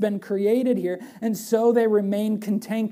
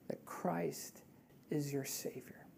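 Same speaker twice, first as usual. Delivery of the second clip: whisper; 3 semitones lower, 14 dB quieter, slower than usual. Real, not acted. Climax of the gospel invitation.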